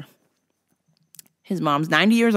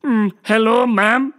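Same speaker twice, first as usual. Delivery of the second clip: Doctor Octopus; 4 semitones higher, 4 dB louder, slower than usual. Retold or imitated. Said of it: "I talk like this because I'm Doctor Octopus".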